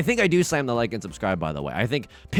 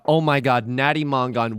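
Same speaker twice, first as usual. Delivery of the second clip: ordinary delivery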